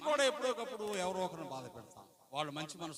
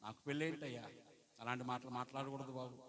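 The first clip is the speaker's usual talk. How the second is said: put-on softer, kindly reproof to a child; gentle correction; 4 semitones lower, 7 dB quieter than usual. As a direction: tell the little one gently that it's wrong. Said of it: "దేవుని బిడ్డ అయితే ఏం మాట్లాడ".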